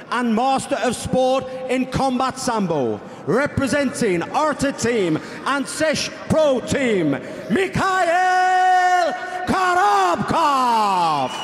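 booming drawn-out fighter introduction; ring announcer. Acted. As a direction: stretch the name out like a ring announcer.

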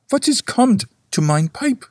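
put-on high, strained old man's curse; old men swearing. A narrator's reading. Old gentlemen swear over their dead pipes, high and strained. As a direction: spit it out like a cross old burgher. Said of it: "swore all the little old gentlemen".

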